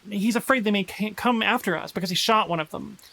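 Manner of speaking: ordinary talk, nothing marked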